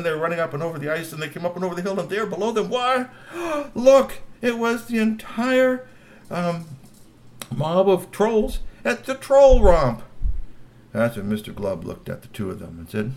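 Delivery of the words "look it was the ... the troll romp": loud high slow amazement; amazed. A narrator's reading. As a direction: gasp and proclaim in wonder. Amazed at big crowd.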